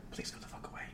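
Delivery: fast whisper under his breath; whispered aside. Acted. Shopkeeper whispers his real feelings to the table. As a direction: hiss it so the customer can't hear.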